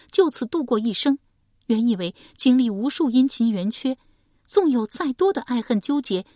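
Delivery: put-on young woman Shi Pei. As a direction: tell it as a wounded daughter.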